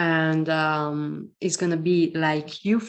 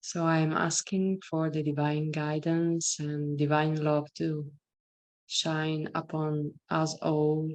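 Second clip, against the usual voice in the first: guided meditation. Acted. Leads a meditation.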